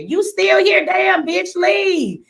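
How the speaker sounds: loud, high shout at someone backstage; yelling her out. Real, not acted. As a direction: yell at her to get out now. Shouting at woman still backstage.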